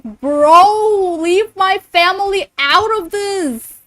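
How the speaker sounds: high, drawn-out protest; exasperated outcry. Real, not acted. Streamer begging chat to leave family out, exasperated outcry. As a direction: plead loudly, stretching the words out.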